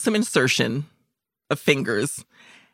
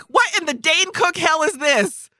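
mock shock; 9 semitones higher than usual. Stunned by the surprise exam.

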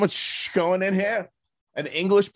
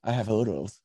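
softer creepy Hannibal purr; creepy Hopkins; 6 semitones lower, 6 dB quieter than usual. Retold or imitated. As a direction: savour the line like a creepy doctor. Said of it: "he goes".

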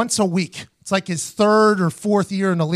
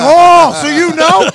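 loud, high, mock-shocked oh; mock surprised shout; 10 semitones higher, 13 dB louder, much slower than usual. To teammates keeping a secret, a loud mock-shocked cry. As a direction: exaggerate the shock, much louder and higher.

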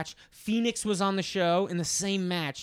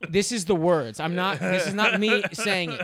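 ordinary delivery

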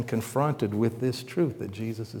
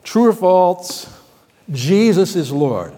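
mock quiz announcement; 7 dB louder than usual. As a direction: pose it like a quizmaster. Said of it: "and I said".

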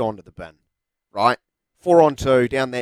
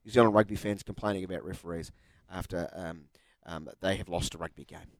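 much softer, mocking voice of a chuckling rival; mock smug guest; 7 semitones lower, 12 dB quieter than usual. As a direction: play the gloating guest under your breath.

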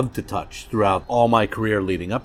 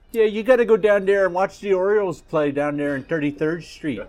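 put-on pitched-up, playful Baltimore accent; 8 semitones higher than usual. Guest hams up a Baltimore accent for the host. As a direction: lay on a Baltimore accent for the Orioles. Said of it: "you trying to do your best Baltimore accent".

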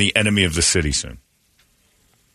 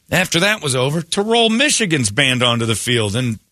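high-pitched incredulous rant, climbing to the end; exasperated outrage; 12 semitones higher than usual. Real, not acted. Host ranting about a second marching band, exasperated outrage. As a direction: voice shoots up in disbelief.

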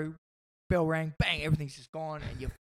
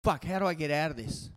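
mild panicked thought; 5 dB louder than usual. Retold or imitated. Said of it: "I'm looking around like".

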